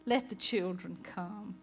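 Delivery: put-on soft, tender plea for the children; gentle Jesus. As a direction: coax softly, like calling children close.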